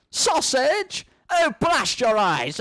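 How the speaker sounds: slow, high, hammed-up curse; mock apoplectic rage; outraged fury. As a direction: explode in theatrical outrage. Johnson outraged at sausage novel.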